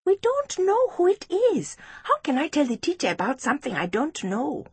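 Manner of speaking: put-on high-pitched child's voice; high, questioning little girl; Precious, puzzled schoolgirl.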